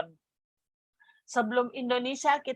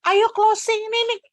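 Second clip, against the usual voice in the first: high, loud, bursting with excitement; excited; 11 semitones higher, 5 dB louder than usual. Retold or imitated. Telling her upline she has the money, high and loud with excitement. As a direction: announce the win to her upline, thrilled. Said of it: "saya bilang".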